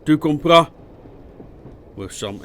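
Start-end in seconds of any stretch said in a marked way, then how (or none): 0.1-0.7 loud, raised French question; demanding bark. A narrator's reading. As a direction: demand an answer from a subordinate. Officer demands answer after threat.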